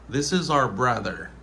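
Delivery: "our" said like the letter R; casual one-syllable "our"; reduced form. Shown as a demonstration. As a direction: flatten "our" to ar. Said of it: "on dit R".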